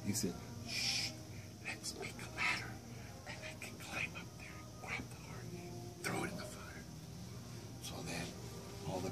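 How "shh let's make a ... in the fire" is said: soft, hushed scheming; secret whispered plan. Acted. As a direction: whisper the plan so the monster won't hear.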